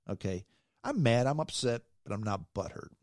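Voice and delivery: normal talking voice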